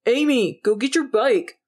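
loud call to another room. Acted. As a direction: shout it down the hall. Calling sister from another room.

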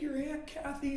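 very high, mock weepy voice; tearful mom. Mom telling the kids about their aunt, tearful. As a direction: imitate a mother crying as she explains. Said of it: "she's crying".

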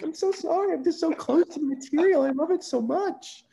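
very high, small, pleading voice; mock-sheepish high apology; high, tearful apology. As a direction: squeak out a teary sorry. Apologizing after crying in a pitch.

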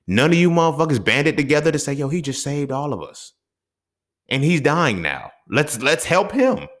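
a rising impassioned appeal; passionate outburst. Real, not acted. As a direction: plead it out, building up high. Impassioned rant about drowned saver.

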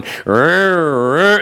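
mimicked chainsaw engine rev; imitated chainsaw revving. Preacher recalling the maze's background noise, imitated chainsaw. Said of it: "you're hearing this chainsaw going".

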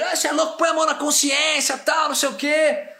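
high, loud shout from a distance; angry stranger yelling as he leaves; shouting from afar. Retold or imitated. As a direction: shout back while walking away. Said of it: "saiu assim de longe".

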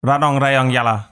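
Ranong, Rayong, Yala in one quick run. Shown as a demonstration. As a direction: rattle off Ranong, Rayong, Yala after the teacher. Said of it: "you say more faster".

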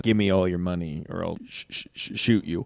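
low, soft, stammering threat; nervous mugger. Acted. Mugger stammering a threat to a victim, nervously. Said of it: "he's kinda nervous".

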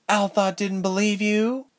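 high-pitched mock-surprised question; sarcastic. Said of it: "Ilse asked sarcastically".

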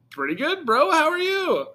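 high-pitched, overdone greeting back; mock friendly reply. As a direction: fake being delighted to see them, voice way up high. Acts out own reply to greeting.

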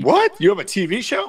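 high, loud incredulous question; mock amazement. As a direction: act astonished at the news. Co-host feigns amazement at news.

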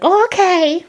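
high Jamaican snap; Jamaican mom. Retold or imitated. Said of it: "she'd yell at me like".

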